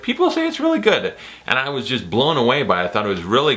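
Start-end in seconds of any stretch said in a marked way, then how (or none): 0.0-1.1 mock naive self. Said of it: "I was just like".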